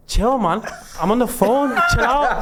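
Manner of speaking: high, laughing mock brush-off; imitating Demi. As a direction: wave them off like you're busy on a call. Demi fakes a phone call.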